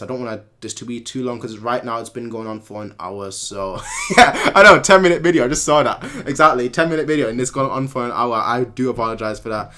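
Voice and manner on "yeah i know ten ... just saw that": loud, amused admission; laughing. Real, not acted. Streamer apologizing for overlong video, laughing. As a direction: laugh and raise your voice as you admit it.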